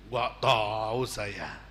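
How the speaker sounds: low, slow mumbled denial; lightly voiced sulky disciple; sheepish disciple. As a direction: play the guilty disciple shrugging it off.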